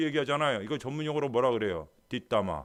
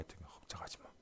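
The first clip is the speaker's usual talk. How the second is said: whisper; 21 dB quieter than usual. Pretend. Jesus whispering reassurance.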